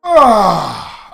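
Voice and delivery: loud falling "ah" of release; relieved groan. Real, not acted. Player finishes covering the text, relieved groan. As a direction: let out a big sigh, high then dropping.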